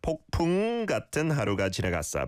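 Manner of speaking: 폭풍 with the ng of 풍 held long; 폭풍 pitched high, its final ng clearly sounded; ieung demo. Shown as a demonstration. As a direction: lean on the ng in 폭풍, then run on quickly. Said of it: "make sure the iung sound".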